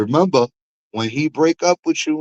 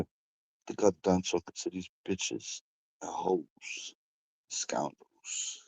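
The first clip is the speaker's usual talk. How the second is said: fading to a murmur; 6 semitones lower, 14 dB quieter, slower than usual. Real, not acted. Fading out, done talking.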